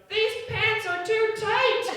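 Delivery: put-on high, slow whiny child voice; a kid whining through the bathroom door; whiny little girl.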